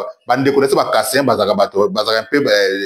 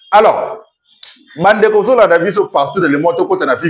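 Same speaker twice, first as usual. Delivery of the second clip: raised, higher-pitched address to the audience; calling out to viewers; 6 semitones higher, 3 dB louder than usual. Real, not acted. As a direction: hail the whole audience, pitched up. Hails viewers all over the world. (interpeller le public d'une voix haute)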